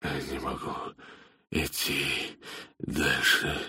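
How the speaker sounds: low, spent voice with pained pauses; exhausted, in pain. A narrator's reading. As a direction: struggle out each word through a stitch. Exhausted man stops, clutches side.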